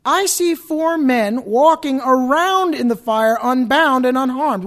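loud, high, drawn-out royal proclamation; proclaiming king. Acted. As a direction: cry out the king's words slowly and loudly. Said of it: "What did the king say?".

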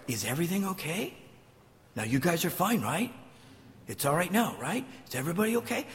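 soft, coddling check-in; mock reassurance. Jesus coddling the disciples after the storm, mock-soothing. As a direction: softly fuss over them, questions lilting up.

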